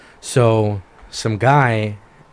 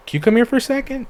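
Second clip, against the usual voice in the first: high coaxing cop voice; 14 semitones higher, 3 dB louder, faster than usual. Retold or imitated. Cop beckons suspect closer.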